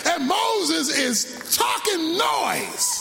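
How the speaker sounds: high, shouted, slow whooping sermon; a preacher whooping from the pulpit; full-force preaching. Acted. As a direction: shout it out as the climax.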